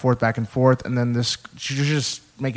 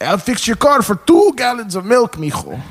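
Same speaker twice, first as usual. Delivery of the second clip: high, exaggerated grandpa voice; grandpa impression; 9 semitones higher, 4 dB louder than usual. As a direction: mimic grandpa making an offer. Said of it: "making fun of my grandpa all the time".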